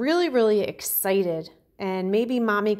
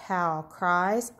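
ordinary talk

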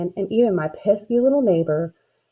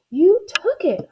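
high-pitched accusation; shriek; 11 semitones higher, slower than usual. A narrator's reading. Girl accusing her sister, high shriek. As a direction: shriek it high, without full volume. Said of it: "I shrieked".

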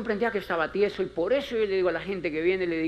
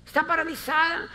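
ordinary talk, nothing marked

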